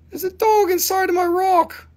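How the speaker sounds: very high, delighted exclaim; excited high voice. Real, not acted. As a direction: squeal with delight at the find. Spots a dog in the rock.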